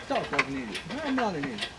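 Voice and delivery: ordinary delivery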